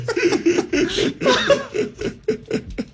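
bursting out laughing. Real, not acted. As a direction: cackle high and long. Big laugh.

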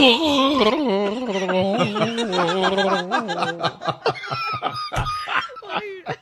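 long, high rolling Wookiee howl; Chewbacca roar. Acted. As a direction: hold a drawn-out Chewbacca wail. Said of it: "can you do a Chewbacca?".

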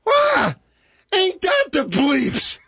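very high, slow imitation of screaming out; mother's scream. Profane mother screams across the room, imitated screech. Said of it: "She just screams out".

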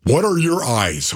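normal talking voice

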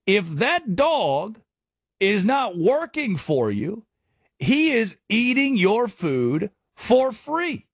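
slow, drawn out, each word leaned on; slow emphatic saying. Shown as a demonstration. Host drives home the dog line to listeners, slow and emphatic. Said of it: "And my question".

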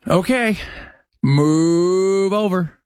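slow fake crying moan; mock wail. Host mocking farmers, slow fake sob.

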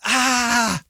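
mild mock scream, high and held; long high-pitched "aah", held back in volume; retold scream. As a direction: imitate your own scream without raising the volume. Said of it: "And I start screaming".

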